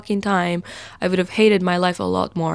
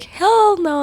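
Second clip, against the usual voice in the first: indignant refusal; 12 semitones higher than usual. Retold or imitated. Refusing the crazy adopter.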